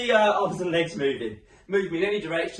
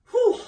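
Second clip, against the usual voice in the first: relieved whew; 9 dB louder than usual. Real, not acted. Blowing out after the stretch.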